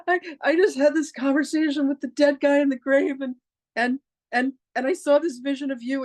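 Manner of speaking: high, tearful retelling of sobbing words; a teary voice that stays high all through; retold crying. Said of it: "I'm crying".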